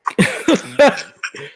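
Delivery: a sudden high burst of laughter; real laugh. Real, not acted. Streamer laughing at his broken connection, a high real laugh. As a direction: laugh out at the mess.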